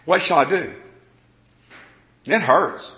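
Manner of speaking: ordinary talk, nothing marked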